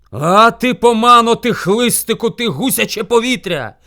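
loud, high-pitched scolding; angry shouting bear. A narrator's reading. Bear yells at the late hare, angry shout. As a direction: yell insults like an enraged bear. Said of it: "кричав на нього ведмідь".

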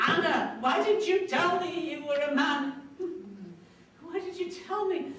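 high-pitched, disbelieving plea; urgent, incredulous appeal; astonished questioning. Retold or imitated. Acted confrontation after the wailing confession.

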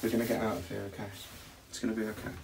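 soft, gentle repeated promise; reassuring. Real, not acted. Promising fiancee they'll get out, reassuring. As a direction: soothe a scared partner quietly. Soothing sick, scared fiancee.

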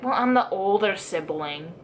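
normal talking voice

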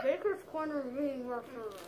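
words mashed by a full mouth; cheeks stuffed full. Real, not acted. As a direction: mouth crammed with marshmallows. Chubby bunny, mouth stuffed.

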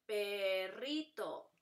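"Perrito" very slowly as pe, rri, to; "Perrito" stretched beat by beat; split into syllables. Shown as a demonstration. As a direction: keep rr whole in a drawn-out "Perrito". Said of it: "no se separan".

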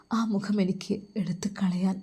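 whispered confession of longing. Acted. Faizal confessing his love for Nusaiba, whispered. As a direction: breathe it out, ashamed.

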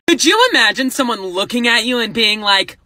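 ordinary delivery

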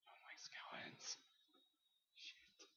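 hushed whisper while sneaking past skeletons; tense, unvoiced whisper. Real, not acted. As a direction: breathe it out so the mobs don't hear.

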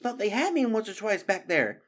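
high, worked-up exclamation; gasped relief. A narrator's reading. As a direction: exclaim after a narrow escape. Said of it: "she gasped".

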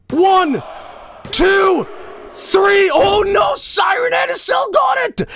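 three-count yelled, then a shocked high shout; excited pin count. Acted. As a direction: count the pin like the crowd's on its feet.

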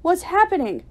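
high, loud anxious question; alarmed cry. A narrator's reading. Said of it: "I cried".

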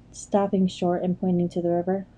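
plain reading voice

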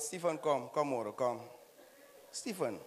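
voiced mother.

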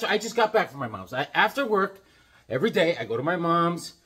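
normal talking voice

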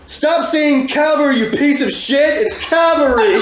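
high, loud, slow all-caps yell. Retold or imitated. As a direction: bellow the angry comment, drawn out. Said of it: "so I am just going to yell it".

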